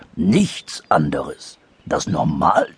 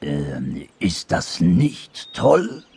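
hesitant; much slower than usual. Acted. Sad anteater asking the dormouse, slow hesitant voice. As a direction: ask haltingly, drawing the words out.

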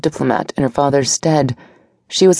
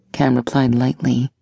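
ordinary delivery

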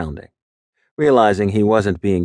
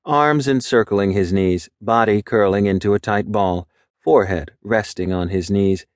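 ordinary delivery

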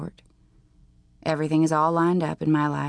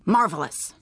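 snort. A narrator's reading. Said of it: "I snorted".

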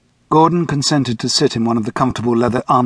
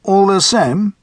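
higher, louder curious chairman; 8 semitones higher, 4 dB louder, slower than usual. A narrator's reading. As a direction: probe gently but urgently. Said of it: "the chairman asked".

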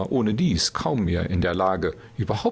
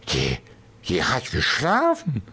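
put-on drunken slur; 3 semitones higher than usual. A narrator's reading. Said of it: "lallte er vor sich hin".

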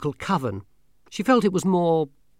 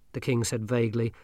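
ordinary delivery, nothing marked

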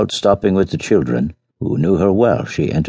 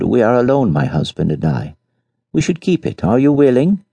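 pleading lady; 7 semitones higher than usual. Acted. Madame pleading.

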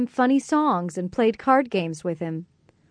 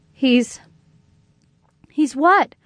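scared; 5 semitones higher, 4 dB louder, slower than usual. A narrator's reading. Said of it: "Nathan was getting scared".

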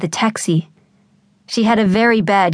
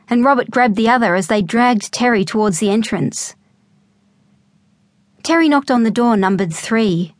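plain reading voice

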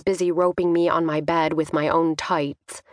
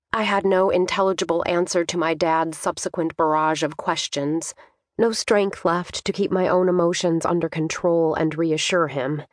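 ordinary delivery, nothing marked

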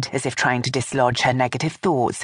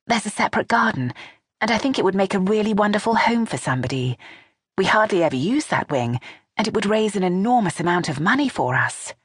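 lightly voiced younger woman, a little higher; a hint of a nervous daughter-in-law; Vanessa voice; 7 semitones higher than usual. A narrator's reading.

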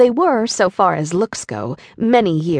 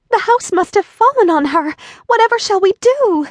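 high, louder, frightened pleading; dismay; 11 semitones higher, 4 dB louder than usual. A narrator's reading. Dorothy fearing what they did, high alarmed cry. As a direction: fret out loud in alarm. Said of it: "cried Dorothy, clasping her hands together in dismay".

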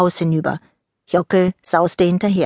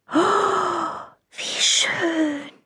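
high, soft wonder; awed hush; 11 semitones higher, 5 dB quieter, much slower than usual. Acted. Niki steps outside and softly marvels at the sunrise. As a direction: breathe it out in awe. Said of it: "blieb sie staunend stehen".

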